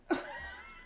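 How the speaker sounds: quick breathy giggle; short high laugh. Real, not acted. Tired self-laugh.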